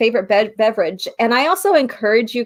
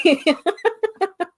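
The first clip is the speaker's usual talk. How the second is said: giggle; 10 semitones higher than usual. Real, not acted. Joking about April Fools.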